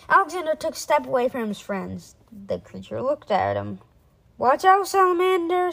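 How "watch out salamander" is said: high, loud warning call; urgent warning. A narrator's reading. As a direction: call out to save a friend. Boy warns friend of monster.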